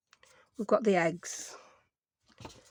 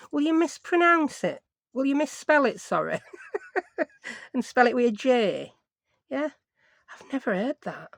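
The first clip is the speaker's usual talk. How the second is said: ordinary delivery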